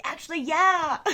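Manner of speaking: high, loud, gleeful yes; acted-out cheeky acceptance; mock eager acceptance.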